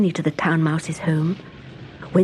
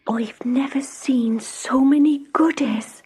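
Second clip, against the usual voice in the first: Country Mouse marvelling at the feast; happy sigh; 6 semitones higher, slower than usual. Acted. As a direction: sigh with delight at the food. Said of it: "she sighed happily".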